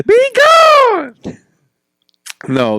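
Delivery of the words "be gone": very high, loud drawn-out holler; hammed-up high-pitched cry; mock banishing shout. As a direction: banish them at the top of your voice.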